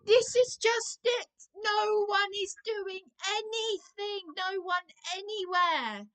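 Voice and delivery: upset caller raising her voice at the support worker. Real, not acted. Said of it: "I'm not raising my voice to you".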